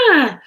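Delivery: high, loud frightened shriek. A narrator's reading. Flap shrieks falling in the dark, frightened. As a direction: shriek in sudden fear. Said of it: "shrieked Flap".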